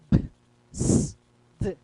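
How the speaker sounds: B, S, D sounded out singly; isolated B, S and D sounds; bare consonant sounds. Shown as a demonstration. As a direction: give the sounds of B, S, D, not names. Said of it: "o som, né, vou ficar".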